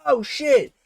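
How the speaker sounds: high, excited exclamation; excited surprise. Retold or imitated. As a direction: gasp it out like a fan caught off guard. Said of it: "made me go".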